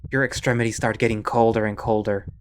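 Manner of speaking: ordinary talk, nothing marked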